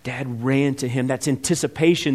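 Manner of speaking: normal talking voice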